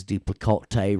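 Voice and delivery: "duplicate" said as doo-plih-kaw-TAY; comic doo-plih-kaw-tay for "duplicate"; playful mispronunciation. Pretend. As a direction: say "duplicate" with a fancy TAY ending. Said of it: "way more fun to say duplicate".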